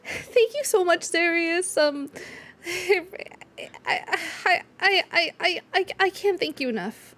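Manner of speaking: high, stammering thank-you; tearful gratitude. Acted. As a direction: choke up over the thanks. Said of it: "They're like".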